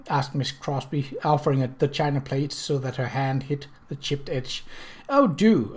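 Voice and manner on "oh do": eager, higher "Oh, do"; urging. A narrator's reading. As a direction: press her warmly to eat. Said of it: "urged the widow Jessup".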